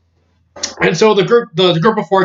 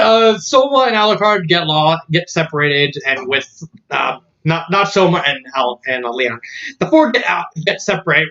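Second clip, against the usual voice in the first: ordinary talk, nothing marked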